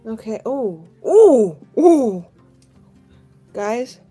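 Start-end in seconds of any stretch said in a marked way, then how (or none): 1.1-2.3 loud, high ooh, falling away; loud oohs of thrilled surprise; excited ooh. Real, not acted. Reacts to a triple six roll.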